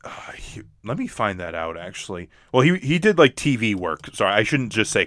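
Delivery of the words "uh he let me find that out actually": whispering. Real, not acted. Whispered aside while searching.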